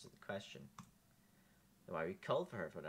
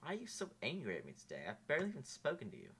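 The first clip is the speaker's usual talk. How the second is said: ordinary delivery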